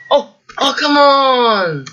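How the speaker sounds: loud, high, drawn-out whine; exasperated. Real, not acted. Player groaning at a failed jump, exasperated. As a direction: wail it in real frustration, stretching the words. Repeated failed jumps in game.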